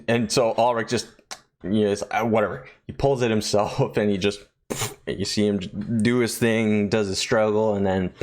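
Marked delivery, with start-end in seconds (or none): none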